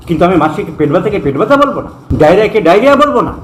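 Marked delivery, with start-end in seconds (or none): none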